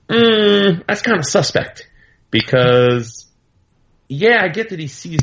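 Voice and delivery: high, skeptical hum then a doubtful drawl; skeptical Tony. Retold or imitated. Tony doubting the kid's visions, in a high skeptical voice. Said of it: "is like".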